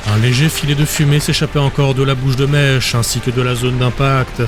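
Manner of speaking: ordinary delivery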